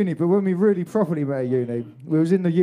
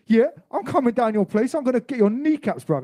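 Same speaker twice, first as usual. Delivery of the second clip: mock threat; 7 semitones higher, 3 dB louder than usual. Retold phone row, reacting.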